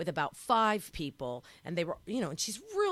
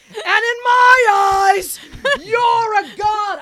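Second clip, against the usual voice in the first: high, loud, slow overexcited scream; star-struck fan shrieking at the singer; screaming at her idol; 19 dB louder, much slower than usual. Retold or imitated. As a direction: blurt it out at the top of your lungs. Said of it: "my voice started to raise, like, a hundred decibels".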